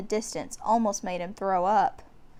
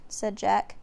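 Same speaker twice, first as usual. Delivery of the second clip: ordinary delivery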